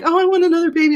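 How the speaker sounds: high mock baby-fever gush; imitating friend. Mimicking Melissa craving another baby, high gushing voice. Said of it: "she's like".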